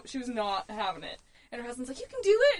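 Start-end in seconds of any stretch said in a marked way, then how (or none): 2.0-2.6 higher, louder cheering on; mock pep-talk call; encouraging husband. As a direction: imitate a husband urging his scared wife on. Husband encouraging crying wife.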